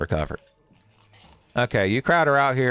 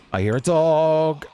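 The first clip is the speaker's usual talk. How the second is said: slow, raised, drawn-out warning; wary alarm; 5 semitones higher, slower than usual. Real, not acted. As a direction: call it out slowly with mild alarm. Hears an enemy dog in game.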